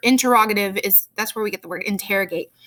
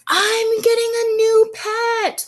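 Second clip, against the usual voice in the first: much higher and slow, bursting with excitement; super excited; 12 semitones higher, 3 dB louder, slower than usual. Retold or imitated. Teacher showing the class an exclamatory sentence, excited squeal. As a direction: squeal it like a kid who just got a pet. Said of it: "like, super excited".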